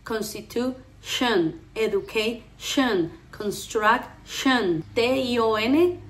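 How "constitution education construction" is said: very slow "constitution, education, construction", each ending in "shen"; "constitution, education, construction" with the final syllable set apart; closed-mouth endings. Shown as a demonstration. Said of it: "la boca como está de cerrada".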